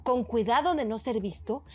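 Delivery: usual speaking voice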